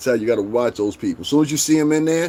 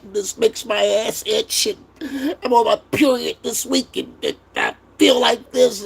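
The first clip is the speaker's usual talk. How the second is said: mock whiny woman; 8 semitones higher than usual. Mimicking a complaining woman online.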